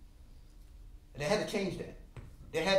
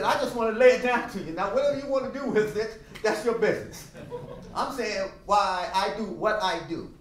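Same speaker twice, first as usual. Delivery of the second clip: firm retort; 6 semitones higher, 7 dB louder than usual. Retold or imitated. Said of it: "I said".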